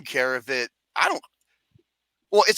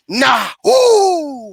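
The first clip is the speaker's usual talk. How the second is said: short word, then a long high hoot; imitated drawn-out "ooh" call; mock cuckoo-like call; 4 semitones higher, 4 dB louder than usual. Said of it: "a fucking cuckoo clock".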